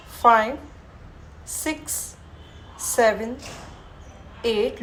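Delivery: firm counting cues; guided yoga count. Acted. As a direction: call out the count steadily. Yoga teacher guiding a held breath.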